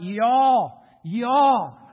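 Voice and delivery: "Y'all, y'all" loud and high, each falling; preacher's loud double "y'all"; emphatic y'all. Shown as a demonstration. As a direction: hammer "Y'all" twice for the plural. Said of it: "you might want to write".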